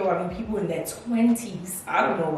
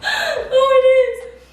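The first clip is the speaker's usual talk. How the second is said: high squealing laugh through the words, louder; 8 dB louder, much slower than usual. Real, not acted. Laughing at her own summer plans, squealing laugh. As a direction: giggle helplessly at yourself.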